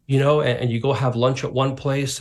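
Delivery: ordinary delivery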